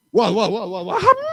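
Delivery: high, rising confused whats; startled elephant. Acted. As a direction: sound baffled and caught off guard.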